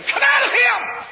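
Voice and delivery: high, slow, full-voice command; commanding shout. Retold or imitated. Preacher rebuking the demon, shouted high and slow. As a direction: cast it out with a ringing yell.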